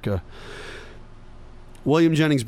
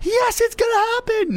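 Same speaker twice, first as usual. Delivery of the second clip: much higher, squealing with hammed-up joy; mock giddy employees; slower than usual. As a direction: cheer like thrilled staff. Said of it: "everybody looks at each other and goes".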